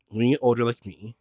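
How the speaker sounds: ordinary talk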